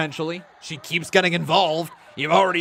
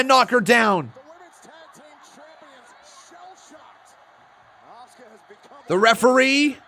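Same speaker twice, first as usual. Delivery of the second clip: excited, louder, higher ringside calls; live play-by-play; 9 semitones higher, 9 dB louder than usual. Acted. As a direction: call the match as it happens.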